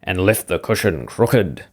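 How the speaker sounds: a touch of big bear, slowly; growling Father Bear. A narrator's reading. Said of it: "growled the Father Bear in his great big voice".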